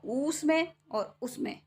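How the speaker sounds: slow ऊस में then short उसमें; long versus short u. Shown as a demonstration. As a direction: contrast ऊस में and उसमें slowly. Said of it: "ये डिफरेंस होता है उसमें और ऊस में". (धीरे ऊस में और उसमें का अंतर)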